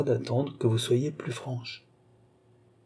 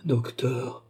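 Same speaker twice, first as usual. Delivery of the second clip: soft, crushed murmur; hushed, faltering address; 6 dB quieter than usual. A narrator's reading. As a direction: let the word out softly, drawn out. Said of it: "murmura Philippe".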